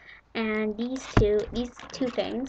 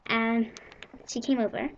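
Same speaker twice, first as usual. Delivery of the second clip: ordinary talk, nothing marked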